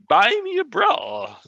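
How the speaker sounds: put-on Southern accent. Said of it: "You had that Southern accent going".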